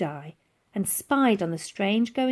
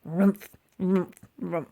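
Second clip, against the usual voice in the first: munching noise; slower than usual. A narrator's reading. Mammoth munching.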